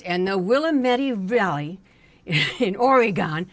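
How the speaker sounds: very loud, pointed "will-a-METTY" for "Willamette"; teacher's defiant mispronunciation. Retold or imitated. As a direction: blare "Willamette" as will-a-METTY, "Oregon" as ory-GONE. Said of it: "and he says".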